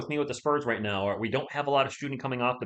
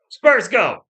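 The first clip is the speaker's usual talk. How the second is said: belted, much higher and louder Spurs chant; loud shout; 9 semitones higher, 13 dB louder than usual. Shown as a demonstration. Streamer shouts to test mic blowout, full-volume chant. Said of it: "We gotta do a loudness test".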